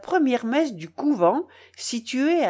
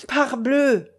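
perked-up exclamation, a bit higher; cheered-up cry; 4 semitones higher, 4 dB louder than usual. A narrator's reading. Bakcheyev suddenly revived, cheerful cry. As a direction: blurt it with fresh energy. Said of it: "cria Bakcheyev, tout ragaillardi".

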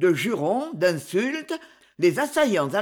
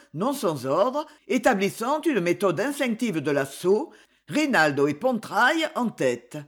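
usual speaking voice

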